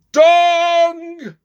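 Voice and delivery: long high loud bong; clock chime. A narrator's reading. Clock striking midnight, a long loud bong. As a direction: ring it out like a big clock bell.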